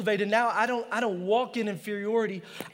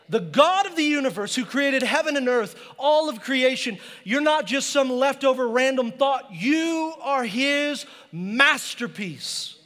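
louder, building sermon declaration; preaching; 3 semitones higher, 4 dB louder than usual. Acted. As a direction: proclaim it to the whole room. Preaching climax.

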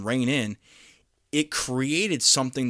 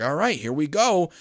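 eager colonists; 5 semitones higher than usual. Retold or imitated. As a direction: play the colonists rubbing their hands, ready to go west. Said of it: "they're like".

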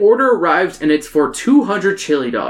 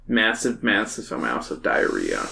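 deep mock emphasis; 7 semitones lower, 7 dB quieter than usual. Joking emphasis, voice drops low.

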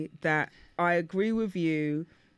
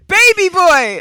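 high, very loud outburst; loud, high-pitched triumphant tease; gleeful shout; 10 semitones higher, 14 dB louder, slower than usual. Real, not acted. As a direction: yell it out to tease your friend. Teasing outburst naming a film.

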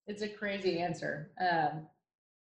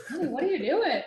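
high, louder mock voice of a chuckling caller; amused stranger; 8 semitones higher, 7 dB louder than usual. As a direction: imitate someone laughing you off over the phone. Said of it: "people would just laugh and say".

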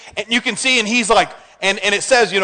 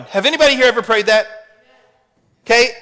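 usual speaking voice

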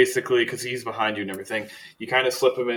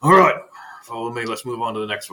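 put-on voice, pitched up; henchman's voice; 5 dB louder, faster than usual. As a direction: call out to the search party as a henchman. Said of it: "And they go".

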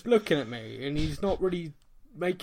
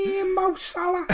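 whining football fan; 3 dB louder than usual. Acted. Mocking upset Liverpool fans, high-pitched mock whine.

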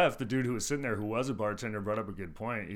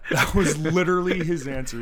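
loud, higher, through real laughter; laughing disbelief; 5 semitones higher, 10 dB louder than usual. Real, not acted. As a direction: crack up while insisting it's true. Laughs at the customer's answer.

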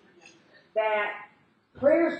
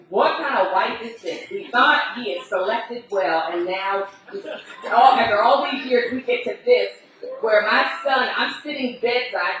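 louder indignant mother-in-law, rising toward shouting; livid mother-in-law; 3 semitones higher, 7 dB louder than usual. Retold or imitated. Mother-in-law rails against the absent wife, building louder. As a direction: scold the absent wife with mounting outrage. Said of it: "she was livid".

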